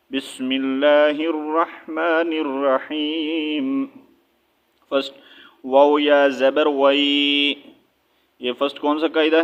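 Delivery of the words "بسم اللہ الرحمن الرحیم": slow, melodic recitation; recited basmala. Shown as a demonstration. Teacher opens recitation for students, slow chant.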